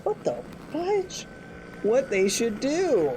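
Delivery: put-on animated reading to children; lively fairy tale voice; storybook narration. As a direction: tell it like a bedtime tale. Tells Chicken Little story.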